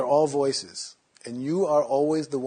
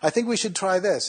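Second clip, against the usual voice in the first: high, louder, eager suggestion; another inner voice; 8 semitones higher, 6 dB louder than usual. Acted. Third inner voice pitching an idea to the others, high mock suggestion. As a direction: pipe up high as one more voice in the head. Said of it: "some other voice says".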